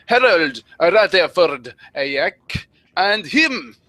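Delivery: hammed-up French announcer flourish; mock French accent. Introducing the fan commentary to viewers, mock French accent. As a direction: put on a showy French accent.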